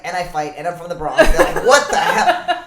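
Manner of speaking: laughing. Retold or imitated. Laughing while telling friends about her background.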